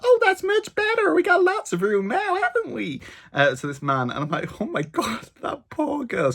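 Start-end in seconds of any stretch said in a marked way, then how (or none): 0.0-3.0 very high, self-satisfied American lady; hammed-up American tourist, pleased with herself; mock American accent. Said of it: "then the lady's like". 4.6-6.3 high, hushed mock dismay; high-pitched retold sympathy; retold dismayed reaction. Own reaction to girl leaving.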